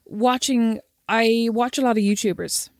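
ordinary delivery

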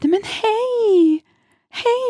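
very high, slow, delighted hei; hammed-up surprised hello; surprised high greeting. Old friend spots her on plane, delighted high greeting. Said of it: "Og han bare".

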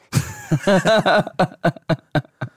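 laugh. Real, not acted. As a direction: laugh out in a string of ha-has. Laughs at own answer. (højlydt latter)

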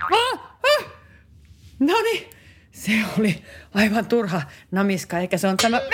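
normal talking voice

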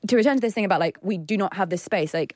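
ordinary delivery

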